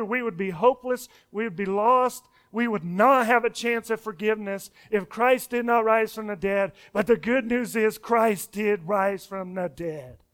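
usual speaking voice

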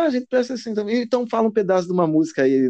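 high mocking girlfriend voice. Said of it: "Aí ela".

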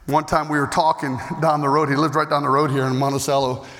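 ordinary talk

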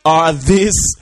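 high, very loud, drawn-out yell; incredulous shout. Acted. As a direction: yell it slowly in scandalised disbelief. Shouted reaction to played clip.

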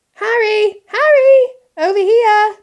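high, loud shout across a street; calling out. A narrator's reading. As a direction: call to a friend far off. Hermione calls out across street.

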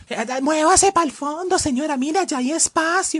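voz aguda y lenta de pasajero mandón; pushy passenger. Retold or imitated. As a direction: empuja a la señora hacia el fondo. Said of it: "pasajero dentro del bus". (pasajero mandón con voz aguda y lenta)

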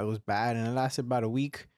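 normal talking voice

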